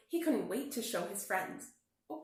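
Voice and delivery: plain reading voice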